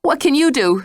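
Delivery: frightened, voice quivering; quavering. Acted. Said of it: "she quavered".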